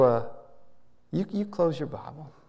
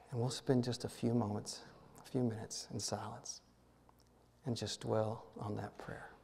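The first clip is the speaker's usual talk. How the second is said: guided meditation; 3 semitones lower than usual. Acted.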